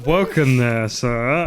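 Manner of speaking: ordinary delivery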